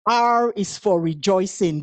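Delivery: ordinary delivery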